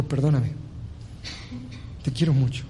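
whisper-close forgiveness to your son; tender plea. Acted. Father hugs son, asks forgiveness. (papá pidiendo perdón con ternura)

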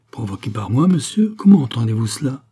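loud, affronted challenge; indignant. A narrator's reading. Queen challenging the doctor's accusation, loudly indignant. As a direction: rise sharply on monsieur in outrage.

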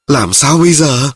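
a scared mother at a loss; frightened mother. A narrator's reading. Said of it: "Thiếu phụ sợ hãi".

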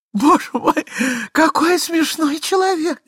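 very high-pitched lady, helpless with mirth; bursting with laughter. A narrator's reading. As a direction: squeal it out through laughter. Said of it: "кричала дама, надрываясь от хохота". (дама кричит, надрываясь от хохота)

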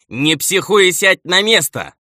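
high, loud, slow command; sharp bark. A narrator's reading. Steward orders the raging elder to sit, barking sharply. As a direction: bark him back into his seat. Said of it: "резко рявкнул управляющий".

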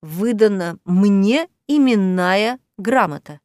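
slow, insistent, with "именная" stressed; deliberate phrasing that presses "именная" home; pointed emphasis. A narrator's reading. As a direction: separate the words and lean on "именная". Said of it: "с нажимом произнесла она".

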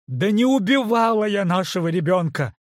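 loud, high furious denial; shouting. Acted. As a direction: yell the denial at him. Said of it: "выкрикнула Кейт".

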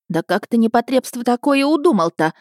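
exasperated auntie giving a dressing-down; scolding woman. A narrator's reading. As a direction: scold the boy indignantly. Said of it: "отчитывала она парня".

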